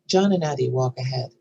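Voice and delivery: ordinary talk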